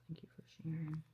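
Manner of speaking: hushed whisper of thanks. Real, not acted. Vlogger thanking a commenter under her breath, whispering. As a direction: whisper it as an aside. Reading a viewer comment aside.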